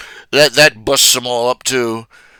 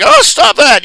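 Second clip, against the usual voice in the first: higher, louder flustered "stop that"; flustered protest; 14 semitones higher, 9 dB louder than usual. Retold or imitated. Ashley flustered by her co-hosts teasing her, high flustered protest. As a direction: squeal it out like someone embarrassed. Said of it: "And she's like".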